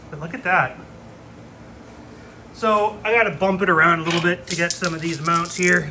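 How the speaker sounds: ordinary delivery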